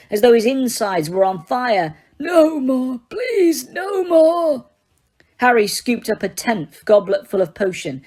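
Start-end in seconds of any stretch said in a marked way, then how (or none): 2.2-4.6 high, crying cry of no more; old man yelling in agony; yelling plea. Acted. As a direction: yell out in pain. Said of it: "he yelled again".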